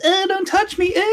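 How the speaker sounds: high-pitched mock squeal; over-the-top recoiling yelp; flustered anime rebuff. Acting out anime girl's startled rebuff.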